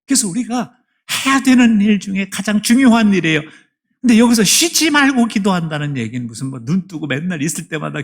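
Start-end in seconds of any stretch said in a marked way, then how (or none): 0.0-6.0 loud, high, rising sermon voice; fiery preaching. Acted. Pastor urging the congregation toward heaven, fiery preaching. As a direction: press the question on the congregation.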